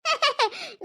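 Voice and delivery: short, soft giggle; quiet little laugh. Acted. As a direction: let out a small teasing giggle. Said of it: "ഇങ്ങനെ ചിരിക്കുന്നെ".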